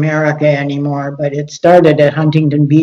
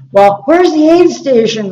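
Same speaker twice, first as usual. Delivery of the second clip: whiny voice; 8 semitones higher than usual. Retold or imitated. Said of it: "had kind of a whiny voice".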